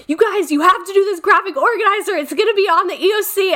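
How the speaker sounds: high, fast and pressing; frantic teacher. Retold or imitated. Teacher rushing the class to finish the worksheet, frantic and high. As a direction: rush them with panic in the voice. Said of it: "just going off on them".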